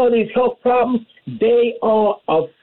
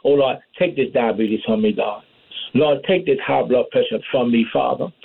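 petition God in a lowered pitch; praying; 7 semitones lower than usual. Acted. Believer naming illnesses to God, low pleading prayer. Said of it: "Sometimes you gotta name it".

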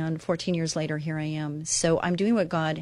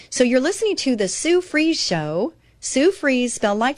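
higher, bright show plug; announcing the Sue Fries Show; radio announcer; 6 semitones higher, 4 dB louder than usual. Acted. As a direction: sell the show like a host.

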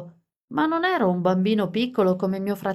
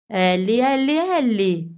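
calling out higher and louder, a mild shout; 3 semitones higher, 3 dB louder than usual. A narrator's reading. Alice calling out to the mother with a mild shout. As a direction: call her name across the beach. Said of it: "gridò Alice".

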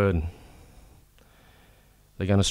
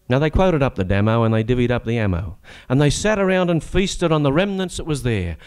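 higher, sing-song reciting voice; comic ballad recitation; 5 semitones higher than usual. Acted. Reciting his comic prawn-war ballad to the audience, sing-song. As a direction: spin the yarn in rhyme for the crowd.

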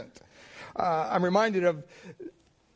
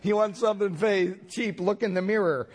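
sassy clerk; 6 semitones higher than usual. Retold or imitated. Tired store clerk zinging the cheap customer, sassy. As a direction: hand him the zinger with attitude. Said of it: "and she said".

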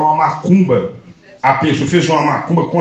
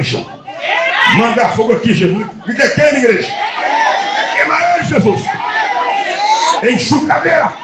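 preaching at full force; 4 semitones higher than usual. Acted. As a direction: drive the demon out at the top of your voice. Exorcism, commanding demons out.

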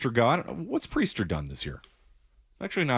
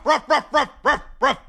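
much higher, louder shrill-free dog yaps; loud yappy barking; 7 dB louder than usual. Shown as a demonstration. Host barks loudly for Breslow's damaged goods. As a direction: yap it out like a small dog. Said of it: "knew it was a ruff".

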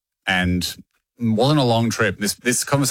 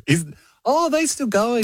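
higher mock-surprised question; imitated viewer; 13 semitones higher than usual. TV host mimics people asking about the show, mock-surprised. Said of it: "not uncommon for people to go".

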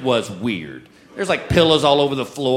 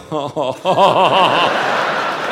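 wavering, drawn-out "oh-ho-ho"; dismayed nervous laugh; 4 semitones higher, 4 dB louder than usual. Retold or imitated. Husband meeting his young masseuse, uneasy laugh. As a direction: let out a long, quavering uneasy chuckle.

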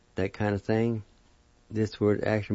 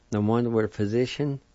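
normal talking voice

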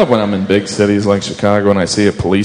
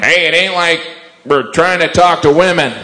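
lightly voiced surgeon, pitched higher; mildly hammed-up brush-off in a higher voice; brain surgeon; 8 semitones higher than usual. Brain surgeons downplaying their own job, higher dismissive voice. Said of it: "What do brain surgeons say?".

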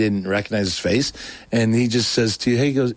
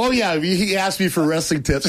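bluffing, laughing; 8 semitones higher than usual. Retold or imitated. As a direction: brag like they're old buddies, laughing. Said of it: "I'm acting like I know him".

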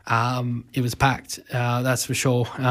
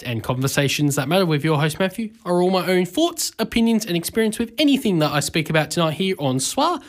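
higher-pitched, rising presenter delivery; radio intro read; 4 semitones higher than usual. Real, not acted. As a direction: read out the station disclaimer, pitched up. Reads show intro and disclaimer.

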